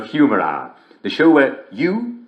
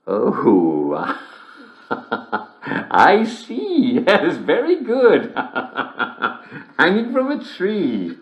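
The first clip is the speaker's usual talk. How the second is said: higher, slow, giggling; giggling realization; 4 semitones higher, much slower than usual. Acted. Host finally getting the joke, giggling to listeners. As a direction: laugh as the joke finally lands.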